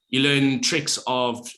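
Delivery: usual speaking voice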